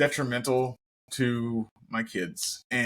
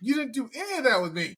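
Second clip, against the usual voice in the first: put-on much higher, fast, childish complaint; indignant inner voice; 12 semitones higher, faster than usual. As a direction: whine it like a jealous kid. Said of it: "I was in my head".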